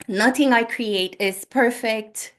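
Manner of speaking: ordinary talk, nothing marked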